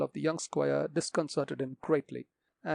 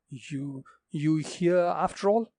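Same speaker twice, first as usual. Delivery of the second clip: fearful shock; 4 dB louder, slower than usual. A narrator's reading. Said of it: "shrill cry of fear".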